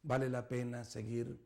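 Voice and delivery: hushed, gentle call to reflect; quiet altar-call voice; soft reflective invitation. Acted. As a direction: speak low and tender.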